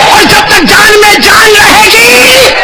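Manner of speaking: high, slow shouted vow; loud pledge. Shown as a demonstration. Preacher shouting the vow with the crowd, high and slow. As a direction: belt it out for the crowd. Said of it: "تو ساتھ میں بولو،".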